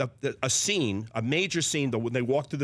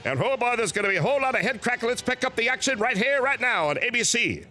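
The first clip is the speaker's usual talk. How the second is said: Keith Jackson; 7 semitones higher than usual. Acted. Doing his famous impression for the hosts, booming higher announcer call. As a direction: call the game like a folksy veteran announcer, rising to a yell at the big run. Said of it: "Let me do it for you right now".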